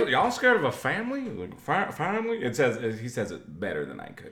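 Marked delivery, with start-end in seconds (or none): 0.0-1.2 put-on high, rising, accented Winston Duke line; Winston Duke impression. To the hosts, imitating Winston Duke's accent in Us. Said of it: "I like your impression". 1.6-2.4 Winston Duke impression. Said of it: "I like your impression".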